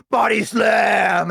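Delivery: drawn-out, high announcer-style cry; mock wrestling call. Friend play-slamming the dog, slow mock shout. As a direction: stretch it out slowly like a slow-motion slam.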